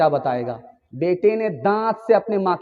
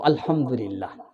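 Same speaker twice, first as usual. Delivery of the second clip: hushed reply; 8 semitones lower, 7 dB quieter, slower than usual. Acted. Daughter answers mother's question.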